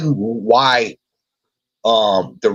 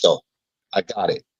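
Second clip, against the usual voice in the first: ordinary talk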